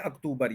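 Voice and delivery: अक्टूबर as uk-TOO-bur, oo in the middle; wrong local pronunciation. Retold or imitated. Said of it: "ये भी गलत हो गया". (अक्टूबर में "टू" की आवाज़)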